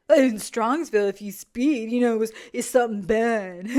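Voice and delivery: loosely imitating a drunken ramble; mock drunk dad. Commentator mimicking the dad's phone warning about speeding, mock drunk voice. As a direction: ridicule his muddled, boozy phrasing. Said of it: "Is he drunk?".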